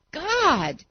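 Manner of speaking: high anguished cry; screaming. A narrator's reading. Falling boy crying out to God, high. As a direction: hurl the word upward in terror. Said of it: "he screamed".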